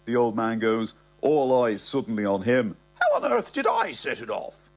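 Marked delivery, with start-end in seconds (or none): 2.9-4.7 high-pitched, flustered protest; much higher, affronted old-man voice; indignant old man. Acted. Old man protesting, all eyes on him.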